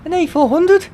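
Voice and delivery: high, loud, thrilled cry of the plane's name; spotting the plane overhead, a thrilled high cry; excited shout. Real, not acted. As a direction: shout it up high in real delight. Plane flies over, thrilled reaction.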